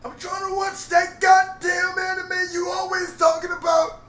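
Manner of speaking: high, loud shouting cranky grandpa. Retold or imitated. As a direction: holler like a grumpy old grandpa. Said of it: "I used my grandpa voice".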